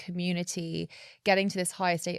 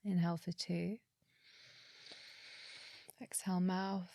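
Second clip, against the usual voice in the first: guided meditation; 7 dB quieter than usual. Acted. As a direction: calmly guide each breath. Leads a breathing exercise.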